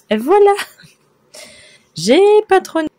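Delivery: high, loud triumphant cry; excited shout. Real, not acted. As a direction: shout it out with pride. Proud reveal of her work.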